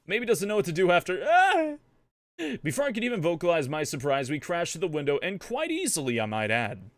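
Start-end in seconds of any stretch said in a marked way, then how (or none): 1.2-1.8 short, high, loud yelp; high acted cry of alarm; startled yelp. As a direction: cut yourself off with a sudden scream. Crash through window in narration.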